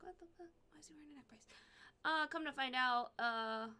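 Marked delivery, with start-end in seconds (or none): 0.0-1.5 soft, muted shock; a quiet stunned question; hushed disbelief. Retold or imitated. Seeing her dad in a neck brace, hushed disbelief.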